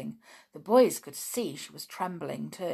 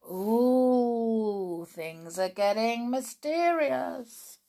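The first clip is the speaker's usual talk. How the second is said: mysterious; 6 semitones higher, 5 dB louder, much slower than usual. Acted. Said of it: "narrator builds intrigue".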